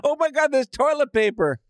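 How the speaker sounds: high-pitched, awestruck shopper; mock amazement. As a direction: exclaim it like a miraculous find, voice lifted high. Acts a shopper's amazed discovery.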